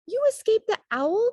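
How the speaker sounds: high, loud joyful cry; high thrilled cry. A narrator's reading. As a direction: cry out at finding mother alive. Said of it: "cried Stellaluna".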